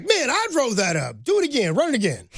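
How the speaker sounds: mock coach demanding a rerun; Coach Carter impression. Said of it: "he's like".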